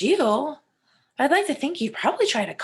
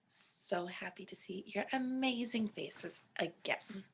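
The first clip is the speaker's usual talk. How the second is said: whispering; 14 dB quieter, slower than usual. Acted. Teacher greeting class in whisper.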